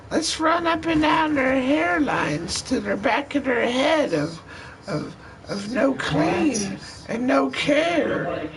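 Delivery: eerie high demon voice taunting the dreamer; creepy succubus voice. Acted.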